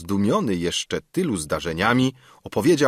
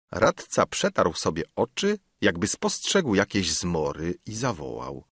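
ordinary delivery

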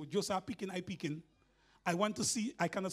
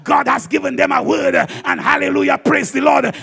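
loud hallelujah cry; shouting praise; 11 dB louder than usual. Retold or imitated. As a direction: shout it out like the church.

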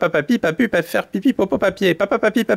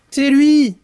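higher, emphatic accusation; accusing call; 6 semitones higher, 5 dB louder than usual. Acted. Canary siblings denounce the culprit, high accusing cry. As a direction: point the finger with a call.

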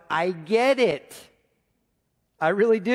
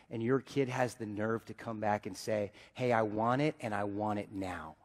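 quietly hurt; 8 semitones lower, 10 dB quieter than usual. Real, not acted. Hurt reflection on a child's rejection.

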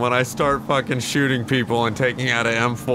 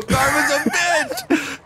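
laughing; 12 semitones higher, much slower than usual. Real, not acted. Laughing at wreck.

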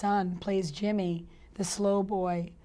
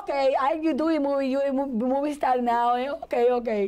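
higher and louder, imitating a Cuban mom's accent; Cuban mother impression; 5 semitones higher, 5 dB louder than usual. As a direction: play the Cuban mom, shrugging it off in her accent. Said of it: "She's like".

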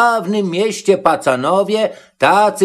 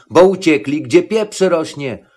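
ordinary delivery, nothing marked

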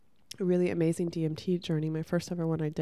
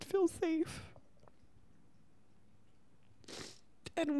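crying; 9 semitones higher than usual. Real, not acted. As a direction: talk through real tears, barely holding it together. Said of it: "uh, expect to cry".

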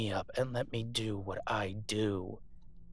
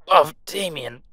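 pained cry; 7 semitones higher, 15 dB louder than usual. Acted. Crying out in pain.

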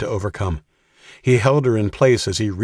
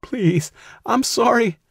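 very high, desperate begging; terrified plea; slower than usual. A narrator's reading. Katie begging her captor, very high and terrified. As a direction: plead in panic, much higher.

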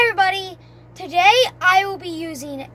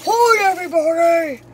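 low voice from the other gas; deep gas voice; 5 dB louder than usual. Retold or imitated. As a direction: drop your voice deep and heavy. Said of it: "the complete opposite".

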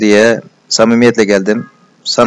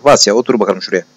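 usual speaking voice

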